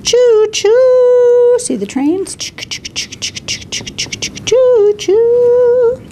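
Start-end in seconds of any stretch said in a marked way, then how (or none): 0.0-1.6 train whistle choo-choo. Acted. Train sound in baby book. 4.5-6.0 train whistle choo-choo. Acted. Train sound in baby book.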